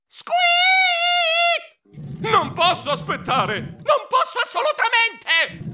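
high, drawn-out wail; desperate squealing. Acted. Mouse who cannot wait for the bathroom, desperate wail. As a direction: wail slowly in rising desperation. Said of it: "strillai disperato".

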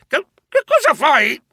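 high-pitched, stammered protest; shrieking. A narrator's reading. Narrator being bandaged whiskers to tail, shrieking. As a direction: squeal out the question in alarm.

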